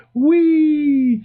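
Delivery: long, high, loud whee; gleeful whee. Retold or imitated. Reviewer mimicking a ride through the time vortex, gleeful squeal.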